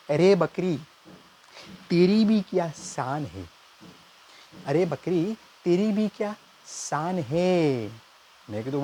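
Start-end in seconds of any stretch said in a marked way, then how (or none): 0.1-3.4 higher, slow, grand poetry recital; mock shayari recital. Nephew reciting a mock couplet to uncle, grand and slow. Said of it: "હું શાયરીની ભાષામાં કહીશ". 4.7-7.9 higher, the last words stretched very slowly; milk the line like a poet waiting for applause; drawn-out shayari. Acted. Nephew repeating the couplet, dragging out શાન હૈ. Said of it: "હું શાયરીની ભાષામાં કહીશ".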